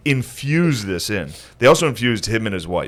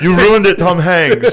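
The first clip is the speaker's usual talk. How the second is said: laughing mock complaint; 8 semitones higher, 6 dB louder, slower than usual. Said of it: "the Nazis being like".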